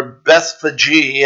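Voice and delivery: "Bethphage" drawn out as BETH-fa-jee, three syllables; variant pronunciation. Shown as a demonstration. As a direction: say "Bethphage" slowly, ending in a clear "jee". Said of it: "as I heard it pronounced recently".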